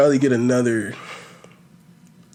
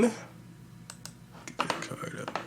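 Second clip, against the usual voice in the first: breathy whispered aside; whispering; 9 semitones higher, 17 dB quieter, much slower than usual. Real, not acted. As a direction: whisper it off to the side. Aside to producer off-mic.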